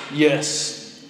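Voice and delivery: lightly imitated Kiwi accent, ominous message; mock-grave delivery with a hint of New Zealand accent. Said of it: "in his Kiwi accent".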